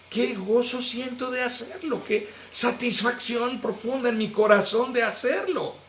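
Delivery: mock joyful gushing. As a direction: gush over your own good deed, half-playing it. Imagined giver voicing joy.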